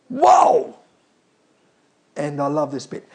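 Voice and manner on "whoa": high, loud astonished whoa; amazed cry. Retold or imitated. Crowd seeing the dead man talk, crying out amazed.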